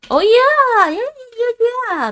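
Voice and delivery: high, loud burst of real excitement; excited realization. Real, not acted. Streamer realizing lekeplassen means playground, loud excited yeah. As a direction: blurt it out as it suddenly clicks.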